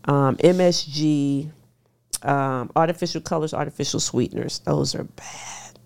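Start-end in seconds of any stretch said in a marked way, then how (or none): 4.6-5.8 hushed and slow, each word weighed; slow warning. Shown as a demonstration. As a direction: lean in and land it slowly, almost breathed. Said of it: "When I say bad, I mean bad".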